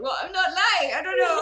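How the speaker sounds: high, loud flustered defence; retold embarrassed protest. Telling the vendor where she's from, embarrassed protest. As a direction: protest through rising laughter. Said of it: "I was totally embarrassed".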